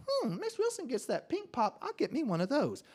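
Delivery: put-on high-pitched kid musing to himself; scheming child. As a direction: think aloud in a high little voice. Child's thought, retold.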